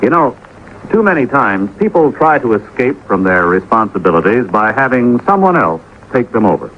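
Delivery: ordinary talk, nothing marked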